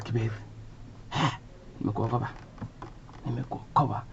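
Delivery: soft, halting mother voice with long gaps; mother on a call. Acted. Mother calling son about the newspaper, soft mother voice. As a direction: play Mum speaking quietly, pausing between words. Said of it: "my mother called and said".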